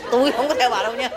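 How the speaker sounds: high, stammering mock protest breaking into laughter; mock strained resistance. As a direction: comically protest while holding yourself back. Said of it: "gồng mình". (giả bộ gồng mình chối, lắp bắp, giọng cao)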